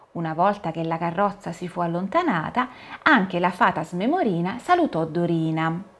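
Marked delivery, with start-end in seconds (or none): none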